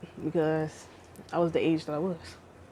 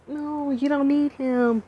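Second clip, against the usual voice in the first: lightly voiced gentle inner voice, higher; 5 semitones higher, slower than usual. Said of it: "that little voice that was like".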